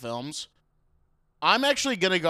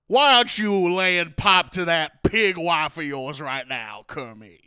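slow, louder mock Orson Welles; 3 semitones higher, 8 dB louder, slower than usual. Host mocking Orson Welles scolding Kermit, slow and loud. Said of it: "This is how he sounds".

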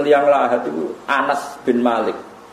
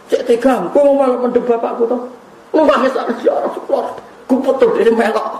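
Fatimah's reproach; 12 semitones higher than usual. Retold or imitated. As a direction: chide him for burying her father. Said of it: "Bareng dicewelok Sayyidah Fatimah". (Fatimah memarahi Anas dengan suara tinggi dan keras)

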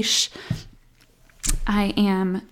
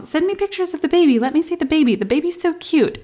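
higher, louder, fast eager gushing; gushing fans; 8 semitones higher, 4 dB louder, faster than usual. Retold or imitated. People begging mom for baby pictures, higher gushing. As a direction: rush it out like an excited fan. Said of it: "You know".

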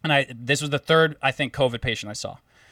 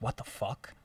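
stunned mutter; 5 semitones lower, 15 dB quieter than usual. Retold or imitated. Shocked on learning patient infected.

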